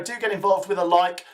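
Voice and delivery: ordinary delivery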